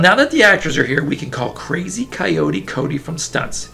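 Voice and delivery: usual speaking voice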